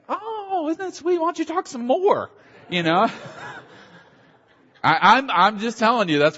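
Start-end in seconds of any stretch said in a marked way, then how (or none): 0.1-2.3 very high, doting, cooing voice; charmed Bostonians. Retold or imitated. Bostonians coo over the Southern woman's accent, very high. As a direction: coo over her like she's adorable. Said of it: "And it's like".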